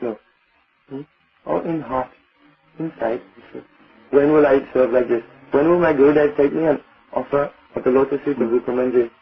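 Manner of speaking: ordinary delivery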